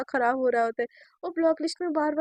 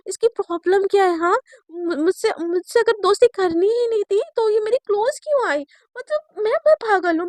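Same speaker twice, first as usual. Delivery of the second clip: fed-up rant; 6 semitones higher, 6 dB louder than usual. Acted. Girl fumes at being ignored.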